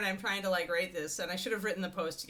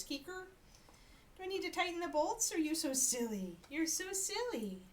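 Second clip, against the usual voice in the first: much higher, teasing pet talk; 10 semitones higher, 5 dB quieter than usual. Real, not acted. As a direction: tease the dog sweetly. Baby talk to the dog.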